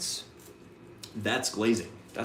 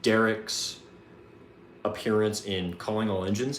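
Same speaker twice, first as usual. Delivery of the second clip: ordinary talk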